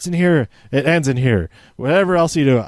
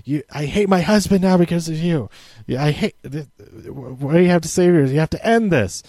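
lightly voiced angry wife, a bit higher; a touch of bitter accusation; retelling Candace scolding Ciampa, mildly angry; mock angry wife; 3 semitones higher than usual. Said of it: "Candace is really angry at Ciampa and is like".

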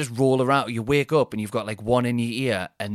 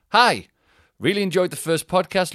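louder, higher bright reading; email read; 7 semitones higher, 6 dB louder than usual. Acted. Host reading a listener's email aloud, brighter. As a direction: read the letter out with pep.

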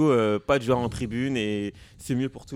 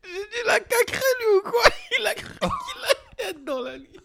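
laughing. Real, not acted. Teasing, high laughing voice.

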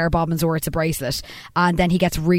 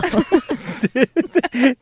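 higher-pitched laughing fit; amused laugh; 7 semitones higher than usual. Real, not acted. Laughing with co-hosts over the nail, high giggles. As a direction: laugh freely at the joke. Joking about wet toenails.

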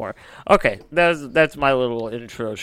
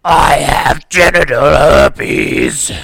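louder, slow strained demon growl; demonic voice; 3 semitones higher, 11 dB louder, much slower than usual. Shown as a demonstration. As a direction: drag it out of the chest. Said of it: "try to do a voice similar to that".

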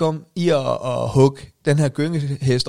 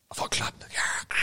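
whispered; 11 dB quieter than usual. Retold or imitated. Retelling a muted crowd response.

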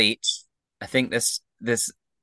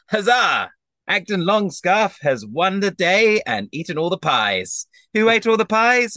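loud, high cry of victory; triumphant proclamation; 10 semitones higher, 4 dB louder than usual. Acted. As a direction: proclaim it like a champion crowing over the table. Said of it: "triumphantly with his hands on his hips".

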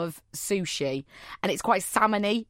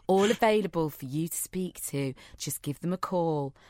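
low, soft seductive phone-line ad; sultry hotline voice; 5 semitones lower, 5 dB quieter than usual. Pretend. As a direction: purr it like a late-night hotline. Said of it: "do one (phone sex voiceover)".

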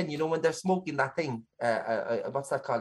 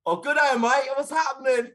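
higher, hammed-up Australian greeting; Australian accent; 6 semitones higher than usual. Shown as a demonstration. Co-host trying accents on request, rough higher Australian accent. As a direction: attempt a breezy Australian accent. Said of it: "give us Australian then".

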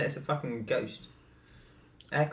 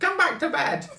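high shouted call; 5 dB louder, slower than usual. Retold or imitated. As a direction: call from the bedroom. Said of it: "someone would be like".

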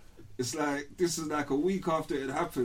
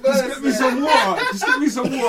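high, loud, laughing plea; laughing mock scream; 9 semitones higher, 10 dB louder than usual. Dazed friend begging for water, loud laughing plea. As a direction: yell it for water while laughing. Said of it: "I'm screaming".

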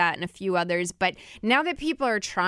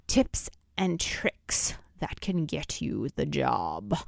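slow spooky witch whisper; 6 semitones lower, 4 dB quieter, slower than usual. Pretend. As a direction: hiss it slowly like a Halloween witch. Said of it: "Dion's laughing at my witch voice".

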